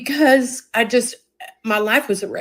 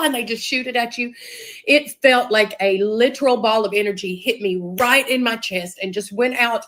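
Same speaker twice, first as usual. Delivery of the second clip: ordinary delivery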